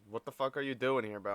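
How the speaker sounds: ordinary talk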